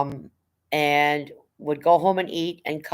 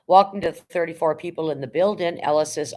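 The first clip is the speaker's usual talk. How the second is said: ordinary talk, nothing marked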